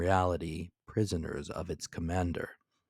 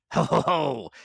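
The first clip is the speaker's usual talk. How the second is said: hearty laugh; 8 semitones higher, 8 dB louder than usual. A narrator's reading. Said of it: "moving his jaws significantly".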